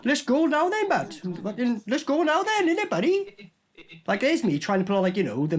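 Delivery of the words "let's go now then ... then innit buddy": light, sing-song Welsh lilt, pitched higher; a hint of a Welsh valleys accent, higher and bouncy; mock Welsh accent. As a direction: tease a mate with a playful Welsh accent. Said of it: "nearly sounded like a Welshman".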